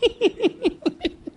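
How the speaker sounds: a high burst of laughter. Real, not acted. Laugh after the story.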